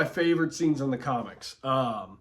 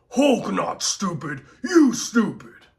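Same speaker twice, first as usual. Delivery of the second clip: rising into high loud Hulk yell; 6 semitones higher, 6 dB louder than usual. Retold or imitated. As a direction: build to an angry giant's shout. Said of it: "he says".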